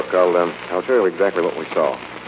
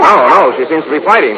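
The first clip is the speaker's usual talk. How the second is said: louder, higher and urgent; urgent alarm; 8 semitones higher, 9 dB louder than usual. Acted. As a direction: push through the panic. Lamont sees the attack.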